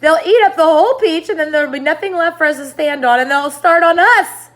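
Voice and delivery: high, loud, fast panic; panicked. Acted. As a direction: rattle off the doom in fear. Miss Spider panics.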